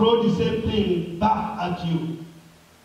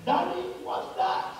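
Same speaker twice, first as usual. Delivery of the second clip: high-pitched lightly voiced boy; puzzled child; 8 semitones higher, 3 dB quieter than usual. Seven-year-old asks his father, puzzled high voice. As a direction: ask up high like a small child. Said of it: "looked at his father and said".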